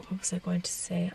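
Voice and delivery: whispered to self; whispering. Real, not acted. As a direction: whisper it under your breath. Whispering to herself.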